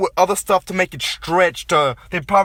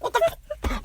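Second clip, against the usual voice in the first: sudden much higher startled yelp; mock baby shock; 8 dB quieter than usual. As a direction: play a newborn startled by an object, jumping way up. Acting newborn baby's startled reaction.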